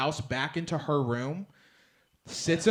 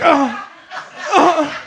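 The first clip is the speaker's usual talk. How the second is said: stammering dad; 10 semitones higher, 11 dB louder than usual. Retold or imitated. Dad fumbling for the trump card.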